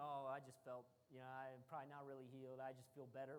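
low, quiet self-talk; doubtful musing. Retold or imitated. Healed man privately doubting his healing, in low, hesitant musing. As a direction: think aloud, playing down your own hope in a low voice.

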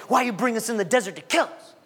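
mock-grumbling Israelite; imitating a complainer's grievance; grumbling complaint. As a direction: complain it as an accusing question, lightly acted. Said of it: "they grumbled and complained".

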